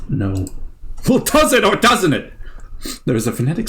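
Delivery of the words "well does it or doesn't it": high, louder demanding question; exasperated. Real, not acted. Host arguing with the game, high exasperated question. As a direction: demand an answer.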